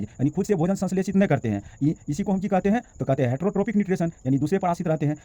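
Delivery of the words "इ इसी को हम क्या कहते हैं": usual speaking voice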